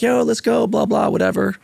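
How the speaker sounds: high-pitched imitation of cheering players; mock hype. Everyone hyping up at the range, high mocking imitation. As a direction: mimic the crowd's hype up high, tossed off.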